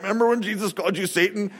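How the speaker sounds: mock-casual teasing, softened; ribbing. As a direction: needle a friend with an old embarrassment. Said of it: "like, ribbing him".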